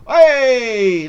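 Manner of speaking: loud, high cheering "hey"; triumphant cheer. Real, not acted. Player cheering a winning dice roll, triumphant cheer. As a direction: whoop it out, long and loud.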